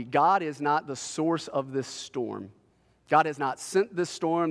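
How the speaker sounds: ordinary talk, nothing marked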